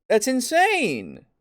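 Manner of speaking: slow, incredulous That's insane; drawn-out disbelief. Real, not acted. Repeated exasperation.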